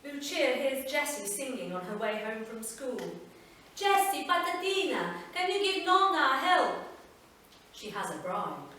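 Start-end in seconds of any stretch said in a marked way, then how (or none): 3.8-6.8 higher, louder voice of an Italian grandmother calling; Italian nonna. Acted. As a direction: call the girl sweetly as her nonna. Grandmother calls the girl.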